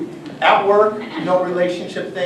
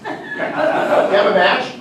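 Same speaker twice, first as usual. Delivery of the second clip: laughing; 7 semitones higher, 5 dB louder, much slower than usual. Real, not acted. Laughing during scene.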